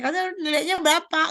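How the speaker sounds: asked high, loud and fast; a parent's too-sharp question to a child; high-pitched question. Shown as a demonstration. Parent asking child about grades, high and loud. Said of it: "nadanya jangan tinggi-tinggi".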